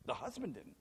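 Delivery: hushed echo of the husband line; quiet echo. Shown as a demonstration. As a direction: drop the voice and repeat it quietly. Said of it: "Well, the husband didn't".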